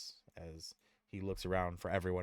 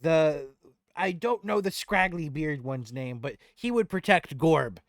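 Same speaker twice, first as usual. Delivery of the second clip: higher, loud goblin voice; goblin recalling names; 10 semitones higher, 13 dB louder than usual. Acted. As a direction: think aloud as a helpful little goblin.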